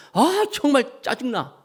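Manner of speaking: high, slow drawn-out grumble; 짜증난 제자가 길게 투덜대는 목소리; irritated. Retold or imitated. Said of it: "하면서". (sigh it out, fed up)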